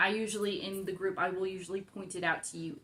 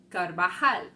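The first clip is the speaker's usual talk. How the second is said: Carvajal with a louder final syllable, car-va-HAL; stress on last syllable; 7 dB louder than usual. Shown as a demonstration. Teacher showing the correct stress to learners, final-syllable stress on Carvajal. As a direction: punch the end of Carvajal. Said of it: "always stress the last syllable".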